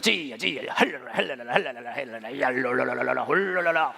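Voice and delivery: rhythmic rolling prayer in tongues; tongues groove demo. Shown as a demonstration. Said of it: "방언 그루브도 한 방언 그루브 하거든요". (방언 기도 그루브 흉내)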